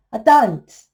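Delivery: ordinary delivery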